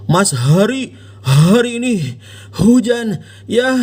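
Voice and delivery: Hari mit schwerem gepresstem H; drück jedes H übertrieben heraus; übertriebenes H. Shown as a demonstration. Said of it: "Anda nggak bilang".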